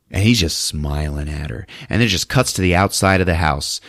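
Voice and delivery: ordinary talk, nothing marked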